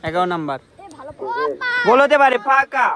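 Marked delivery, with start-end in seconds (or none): 1.9-2.9 excited commentary. Real, not acted. Excited goal-chance call.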